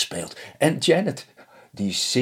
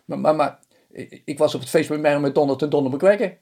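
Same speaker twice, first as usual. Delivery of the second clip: higher, stuttering excuse; stammering husband; 7 semitones higher, 3 dB louder than usual. Acted. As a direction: fumble for an excuse in a high voice.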